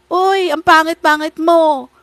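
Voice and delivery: put-on loud, high childish taunt; playground bully. Kid teasing another on the playground, loud childish jeering. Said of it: "Di ba yung mga bata sa playground".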